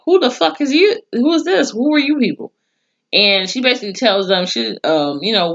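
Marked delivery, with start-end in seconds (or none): 0.0-2.5 indignant shock. Retold or imitated. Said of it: "Kathy Bates' character was like".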